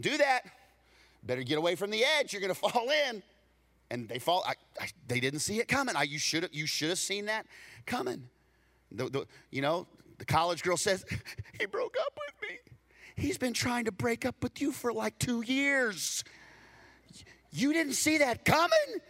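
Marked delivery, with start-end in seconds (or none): 0.0-3.2 higher, louder quick warning; warning call. Retold or imitated. Friend warning someone away from the edge, loud call. 11.6-19.0 put-on much higher, falsetto girly voice; mock college girl. Preacher voicing a college girl's breakup complaint in falsetto.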